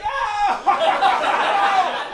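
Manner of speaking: high, loud mock scream; stressed-out scream. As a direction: scream like an over-caffeinated, stressed-out Jesus. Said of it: "also a very stressed guy".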